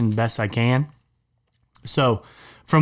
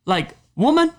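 outraged rant; 8 semitones higher than usual. Real, not acted. Outraged rant about the will.

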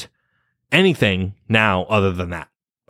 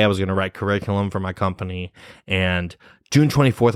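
usual speaking voice

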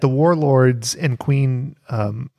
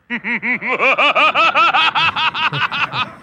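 hearty cackling villain laugh; evil chuckle; 13 semitones higher than usual. Acted. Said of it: "hearty villain laugh from Lord Viper".

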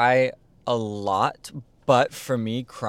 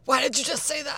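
retold scream; faster than usual. Daughter snapping back at dad, high mock scream. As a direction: squeal it out as an imitated scream. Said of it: "I literally screamed back".

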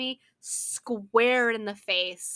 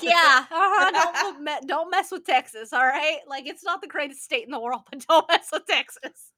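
pitched up, laughing swagger; cocky laughing taunt; 4 semitones higher, 6 dB louder than usual. Retold or imitated. Bragging to a friend, a cocky laughing taunt. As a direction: gloat with a laugh, louder. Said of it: "And I'm like".

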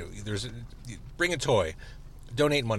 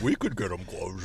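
cartoon character voice; 4 semitones lower, 3 dB quieter than usual. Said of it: "like a cartoon character".

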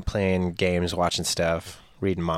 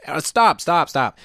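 raised, higher repeated call; mock-urgent holler; acted urgent shout; 7 semitones higher, 5 dB louder, slower than usual. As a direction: call after someone running away, playacting. Acted chase after intruders.

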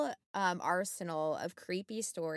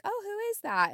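much higher puzzled "oh, who is that"; surprised question; 14 semitones higher, faster than usual. Retold or imitated. Nurse spotting a resident in the hall, high surprised. Spots figure walking in hallway.

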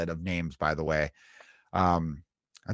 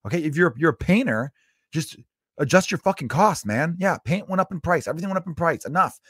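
fed-up bartender; 7 semitones higher than usual. Retold or imitated. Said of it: "But even she was like".

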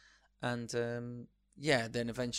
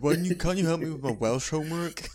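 put-on high, loud pleading teenager; a kid whining loudly at dad over homework; nagging teen; 6 semitones higher, 13 dB louder than usual. Mock teen line in sitcom.

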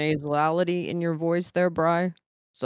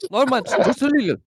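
mock Pav; 7 semitones higher, faster than usual. Pav impression.

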